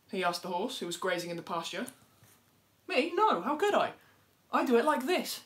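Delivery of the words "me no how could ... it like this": high, loud, prim denial; a smug little bird protesting her innocence; innocent animal voice. Acted. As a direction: play the dove, shocked at the idea. Horse denies it, shows how.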